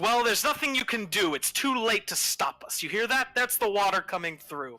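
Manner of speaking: high-pitched, insistent taunt; gloating henchman. Acted. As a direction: gloat that it is too late to stop you. Voices the gloating enemy man.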